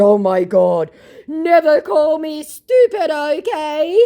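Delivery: high, slow, indignant scammer voice; whiny put-on scammer protest; mock offended scammer. Scammer's reply read out angrily.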